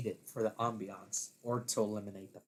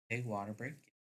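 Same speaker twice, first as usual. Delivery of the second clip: plain reading voice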